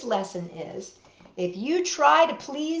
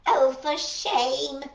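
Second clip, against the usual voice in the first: scolding; 3 semitones higher, slower than usual. Acted. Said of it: "she scolded".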